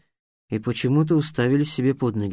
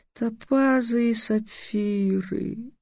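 slow, soft, savoring each word; dreamy longing; 8 semitones higher, 4 dB quieter, much slower than usual. A narrator's reading. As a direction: relish the treasures. Sphinx dreams of gems.

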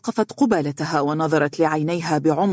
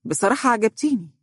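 higher-pitched candid confession; bold, frank; 6 semitones higher than usual. A narrator's reading. Lawyer admitting his liking to an employee, boldly. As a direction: say it forward and unembarrassed. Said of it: "قال بجرأة وصراحة".

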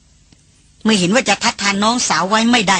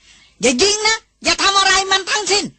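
much higher, slower, strained cry; voice cracking cry; 10 semitones higher, slower than usual. A narrator's reading. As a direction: cry out desperately so nobody shoots. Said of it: "ร้องบอกทุกคนเสียงหลงมาว่า". (ร้องเสียงหลง สูงมาก ช้าลง)

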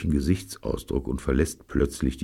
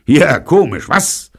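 loud, high-pitched sneer; mock him with a sharp, raised voice; scornful taunt, pitched right up; jeering; 12 semitones higher, 11 dB louder than usual. A narrator's reading. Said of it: "höhnt Sargnagel".

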